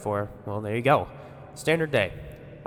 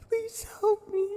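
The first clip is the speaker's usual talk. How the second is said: put-on tearful child plea; slower than usual. Crying child pleads.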